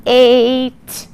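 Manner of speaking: "Eight" stretched and loud with long A; sounded-out word. Shown as a demonstration. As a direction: segment "Eight", leaning on the long A. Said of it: "change that a to an A".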